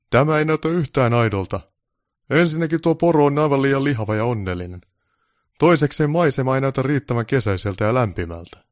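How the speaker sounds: snooty boss voice; displeased director. Acted. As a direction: find fault with everything. Director complains about the shot.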